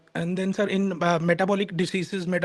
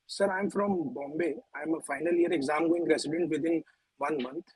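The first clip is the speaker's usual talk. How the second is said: barely audible; 2 semitones lower, 6 dB quieter than usual. Real, not acted. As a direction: speak very softly, hard to hear. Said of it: "his voice is very low".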